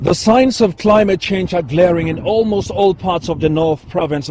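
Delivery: on-location reporter, steady news delivery; news field reporter. Acted. On-location climate change report.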